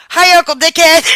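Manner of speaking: put-on high, loud toddler greeting; child greeting her uncle, high and loud; little girl voice. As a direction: chirp it like a small child. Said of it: "she goes".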